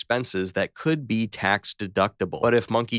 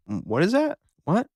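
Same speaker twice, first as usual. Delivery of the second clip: surprised; 8 semitones higher than usual. Acted. Monkey squinting at tax form.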